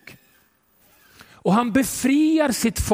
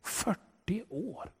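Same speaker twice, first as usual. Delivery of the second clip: very soft and slow; the preacher letting forty years sink in; hushed emphasis; 14 dB quieter, slower than usual. Acted. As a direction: let it sink in, barely spoken.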